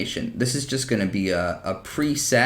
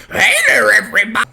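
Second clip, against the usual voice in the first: loud, high cartoon greeting; too loud; 11 semitones higher, 10 dB louder, faster than usual. Shown as a demonstration. Host testing the mic, greeting far too loud. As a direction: blast the hello way too loud. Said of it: "That's too loud".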